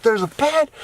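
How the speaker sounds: put-on high-pitched kid's discovery, lifting at the end; amazed niece. Niece exploring the van, amazed. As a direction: imitate an excited child.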